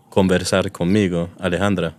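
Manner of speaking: normal talking voice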